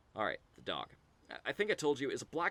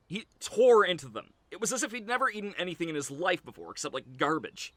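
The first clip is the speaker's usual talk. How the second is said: loud, raised, worked-up storytelling; excited telling; 6 semitones higher, 9 dB louder than usual. Acted. Describing the dog tearing into the meat, excited telling. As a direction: tell it with ravenous energy, loudly.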